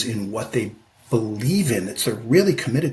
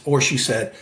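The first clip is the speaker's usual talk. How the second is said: ordinary talk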